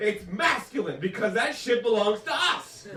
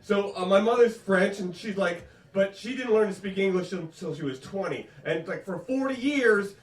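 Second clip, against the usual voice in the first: normal talking voice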